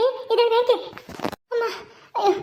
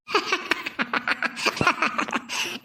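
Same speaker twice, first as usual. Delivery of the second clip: long, softer mocking cackle; defiant cackle; 6 dB quieter than usual. Woman told to shut up, long defiant laugh. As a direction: keep cackling despite the warning. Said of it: "ನಗ್ತೀಯೇನೆ?".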